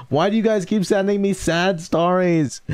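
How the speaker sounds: high, whiny protest; whiny complaint. Real, not acted. Streamer whining to chat about sad stories. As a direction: whine at them playfully.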